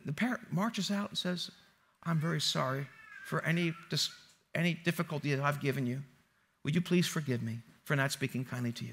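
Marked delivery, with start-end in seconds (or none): 2.0-8.9 low, soft, formal apology; subdued and meek, pitched down; mock contrite. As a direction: play a humbled parrot begging pardon. Chastened parrot apologizes in joke.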